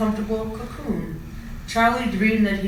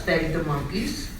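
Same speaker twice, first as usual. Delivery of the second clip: ordinary delivery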